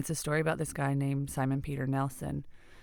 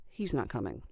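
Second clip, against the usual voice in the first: lower, subdued falling delivery; blunt letdown; 4 semitones lower, 4 dB quieter than usual. Retold or imitated. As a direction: imitate mom dropping the bad news, pitch sinking. Mother breaks news of absent father.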